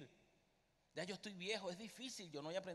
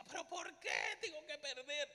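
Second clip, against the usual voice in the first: desperate protest; 9 semitones higher than usual. Retold or imitated. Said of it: "Yo le decía".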